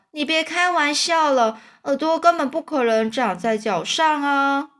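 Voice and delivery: put-on high child voice, protesting; disbelieving boy. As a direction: object like an incredulous kid. (小男孩不相信地高声反驳)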